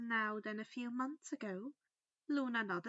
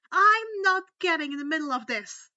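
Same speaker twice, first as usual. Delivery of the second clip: loud indignant refusal, a bit higher; 5 semitones higher, 13 dB louder than usual. Acted. Draco refusing to get involved, loudly indignant. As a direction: push back hard, outraged. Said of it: "He called out indignantly".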